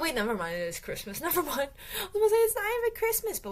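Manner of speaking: ordinary talk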